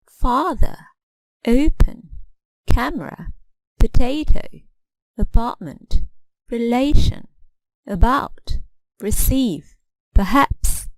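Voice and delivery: "Father, open, camera" stressed first, "potato, apartment, relation" middle, "about, receive, perhaps" last; word-by-word drill from "Father" to "perhaps", each stressed syllable prominent; modelled word stress. Shown as a demonstration. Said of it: "try to apply stress in your pronunciation".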